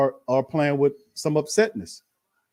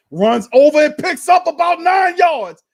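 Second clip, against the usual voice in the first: rising, louder and higher as the run breaks; excited shout; 12 semitones higher, 10 dB louder than usual. Real, not acted. Stream commentator to chat, shouting the big run. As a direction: yell it out as the back bursts through.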